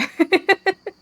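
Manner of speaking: a quick high giggle. Real, not acted. DM giggles at the players' confusion, high and quick. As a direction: giggle up high at your own line. Laugh after quip.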